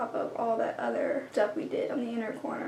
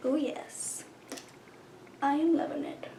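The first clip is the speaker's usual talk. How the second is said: whispering; 3 semitones higher, 6 dB quieter than usual. Real, not acted. Whispered delight at makeup.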